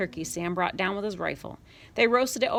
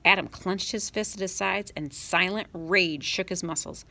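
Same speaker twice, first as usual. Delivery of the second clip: ordinary delivery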